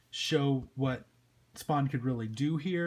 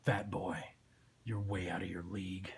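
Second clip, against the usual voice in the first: low, hushed put-down; dark lowered antihero voice; menacing Spawn; 5 semitones lower, 7 dB quieter than usual. Acted. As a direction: belittle him softly and low.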